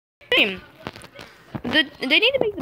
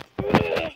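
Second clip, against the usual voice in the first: real laugh; 9 semitones higher than usual. Real, not acted. Laughing at brother's blunder.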